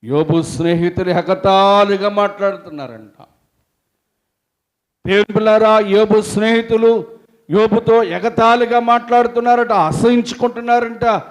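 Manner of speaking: loud, high fervent sermon voice; full-force preaching. Acted. Preacher retelling Job's friends to congregation, fervent. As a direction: preach it out with fire.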